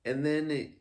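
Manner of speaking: normal talking voice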